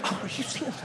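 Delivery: high, soft, breath-filled disbelief; hushed, hammed-up incredulous aside. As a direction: share mock disbelief with a stranger.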